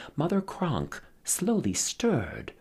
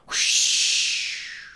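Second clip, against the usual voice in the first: whooshing wind; 3 dB louder than usual. Acted. Last gust of wind.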